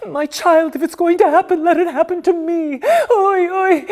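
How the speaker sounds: put-on mother clutching her child, crying out in fear. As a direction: cry it out, half-sobbing, shielding her child. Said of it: "and cried".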